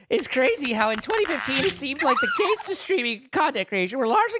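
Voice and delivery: high, lively character read; put-on message voice. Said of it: "read my message in a, in a voice".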